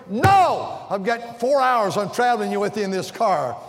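high, loud mock refusal; mock shout. Pastor hamming up his refusal to a pestering passenger, shouting. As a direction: shout it out like a comic exasperated no.

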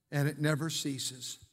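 normal talking voice

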